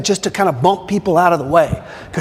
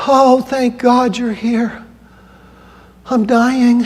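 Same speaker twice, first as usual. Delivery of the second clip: dramatic patient; 7 semitones higher, slower than usual. Retold or imitated. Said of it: "She goes".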